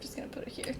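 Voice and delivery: unvoiced whisper to herself. Real, not acted. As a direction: whisper while drawing. Muttering while drawing.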